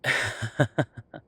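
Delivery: a quick, loud laugh; short laugh. Real, not acted. Short laugh to partner about paperwork. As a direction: break into a brief laugh. Laughs at own bureaucrat remark.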